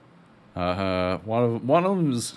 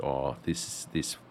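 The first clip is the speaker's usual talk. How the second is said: low muttering Bond; 7 semitones lower, 8 dB quieter than usual. Retold or imitated.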